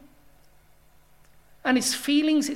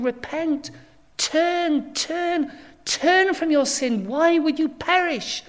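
rising to a loud, pleading cry; Jesus calling sinners to turn, loud pleading; 4 semitones higher, 7 dB louder than usual. Acted. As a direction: call them home, urgent and imploring. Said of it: "he says".

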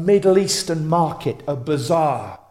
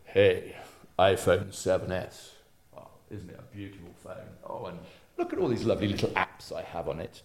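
lower, much softer, fast mock cooing; mock admiring; 8 semitones lower, 10 dB quieter than usual. Preacher mocking people admiring phones, soft mock cooing. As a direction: coo over the phone in mock delight. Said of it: "do you go".

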